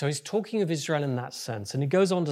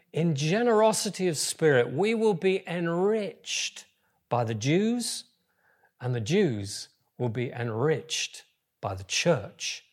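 ordinary talk

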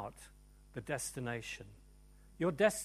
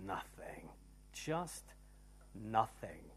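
low, soft, near-whispered answer; intimate, lowered preacher's voice; hushed emphasis; 6 semitones lower, 9 dB quieter, slower than usual. Real, not acted. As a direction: let each word drop quietly, with pauses. Preacher drops voice for key word.

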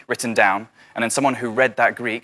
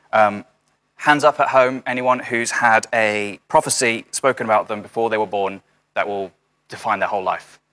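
ordinary talk, nothing marked

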